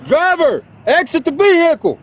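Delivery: loud high command; commanding shout. Real, not acted. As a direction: bark orders at the driver. Felony takedown command.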